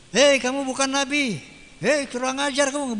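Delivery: mock angry insult. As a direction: play the rude accuser for the crowd. Imagined hostile insult.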